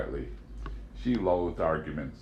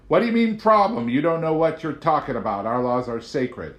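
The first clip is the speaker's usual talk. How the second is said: much higher, louder indignant outburst; outraged agent; 8 semitones higher, 7 dB louder than usual. A narrator's reading. Agent outraged at Amanda's remark, high and loud. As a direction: bristle in disbelief.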